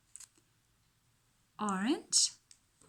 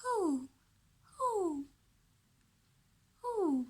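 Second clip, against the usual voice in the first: owl hoot; 7 semitones higher, slower than usual. Shown as a demonstration. Teacher imitates owl call.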